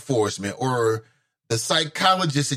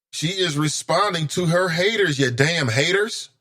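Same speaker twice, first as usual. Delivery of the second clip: ordinary delivery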